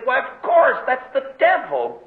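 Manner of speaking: the penny drops, said aloud; sudden realization. Retold or imitated. Preacher recalling his realization on the train, eager discovery.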